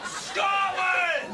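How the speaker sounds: defiant shout. Acted. Trapped man joining his friend against the lift, defiant shout. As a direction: bellow it as a patriotic rallying cry.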